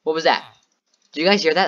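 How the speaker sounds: startled and scared. Real, not acted. Gamer spooked by a noise asking viewers what that was. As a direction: jump at every sound and ask the chat.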